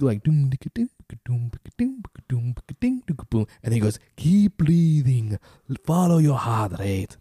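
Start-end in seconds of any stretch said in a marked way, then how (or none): 0.2-3.5 rhythmic mouth-music doom dicka beat; beatbox music imitation. Imitating the app's breathing music for his friend, mouth-music beat. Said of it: "you hear, like, cool little music". 4.2-7.2 slow, higher put-on African accent, stretched out; half-joking attempt at the coach's accent that lands African; mock African accent. Imitating the breathing coach's voice for his friend, slow put-on African accent. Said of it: "That's more African".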